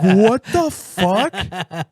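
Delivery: loud, high, drawn-out shock; shocked disbelief. Retold or imitated. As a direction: retell stunned disbelief, loud and slow. Two boys react to coach's remark.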